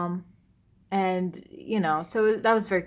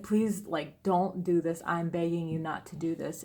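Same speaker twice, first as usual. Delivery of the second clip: retold tearful begging; 3 semitones lower, 7 dB quieter than usual. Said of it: "the fact that I was crying".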